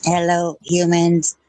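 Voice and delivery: low, eerie AI voice; robot greeting. Acted. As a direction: announce yourself like a machine. AI character Missy greets.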